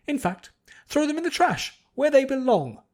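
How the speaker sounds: high, loud and haughty, scolding the servants; snooty lady scolding. A narrator's reading. Noblewoman berating her servants, high and loud. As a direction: berate them like fools beneath her. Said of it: "a snooty, pretentious voice".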